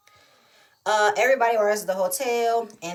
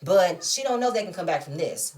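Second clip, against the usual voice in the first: normal talking voice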